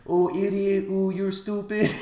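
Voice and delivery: high, drawn-out taunting voice; hammed-up high voice calling names; mocking scold. Whis holds Beerus's ear open for the insults, mock scolding.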